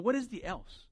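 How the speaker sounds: normal talking voice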